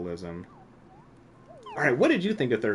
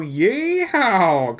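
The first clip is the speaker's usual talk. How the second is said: high, loud cowboy Texan Yeehaw; a hammed-up Texan rodeo whoop; Texan Kupo; 10 semitones higher, 10 dB louder than usual. Streamer imagining Kupo as a Texan cowboy, mock Texan yell. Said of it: "a hard Texan accent for, uh, for Kupo".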